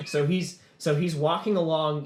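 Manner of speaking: ordinary talk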